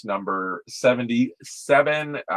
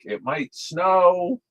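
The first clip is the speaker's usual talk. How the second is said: higher, slow, drawn-out cat voice; imitating pet cat; 9 semitones higher, slower than usual. Owner voicing his cat's thoughts about the weather, playful cat impression. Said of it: "He's like".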